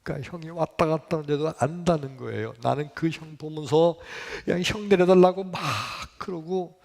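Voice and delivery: ordinary delivery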